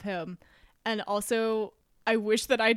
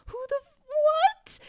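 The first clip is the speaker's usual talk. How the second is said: high, loud disbelieving squawk; a sudden shocked "what?"; baffled outburst; 7 dB louder than usual. Retold or imitated. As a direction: react like you can't believe it. Her own baffled reaction quoted.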